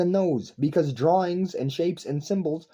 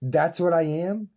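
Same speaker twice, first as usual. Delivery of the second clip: ordinary talk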